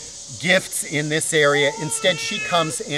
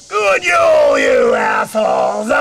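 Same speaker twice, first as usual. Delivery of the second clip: rude hag greeting; 12 semitones higher, 8 dB louder, slower than usual. Acted. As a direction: bellow it like a crude old witch barging in. Mother Berchta greets the household.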